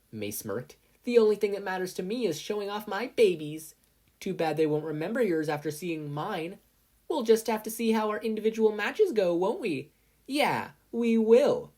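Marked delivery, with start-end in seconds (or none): 1.0-9.9 higher, louder gloating; smug boast. A narrator's reading. Mei smirking boast. 10.3-11.7 higher, loud, very slow defiant reply; challenge accepted. A narrator's reading. Mina answering challenge.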